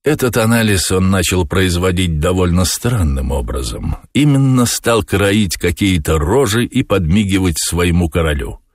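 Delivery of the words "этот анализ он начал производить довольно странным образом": ordinary delivery, nothing marked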